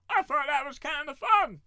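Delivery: high, loud, cartoonish voice; silly put-on voice. Narrator joking to viewers after the story, silly high voice. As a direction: squeak it out like a goofy character.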